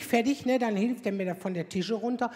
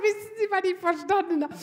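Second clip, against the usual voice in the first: laughing; 8 semitones higher than usual. Retold or imitated. Laughing fit with doctor.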